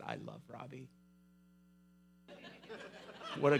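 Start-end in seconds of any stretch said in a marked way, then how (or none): 0.0-0.9 softly and reverently, mock-sincere; a hushed, dreamy fake conversion; mock tender. Preacher joking about gang leader's fantasy reply, softly mock-tender.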